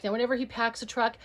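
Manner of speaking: normal talking voice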